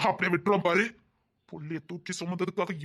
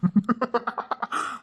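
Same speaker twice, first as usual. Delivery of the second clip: menacing laugh; 4 semitones higher than usual. Acted. Captor laughs at her defiance.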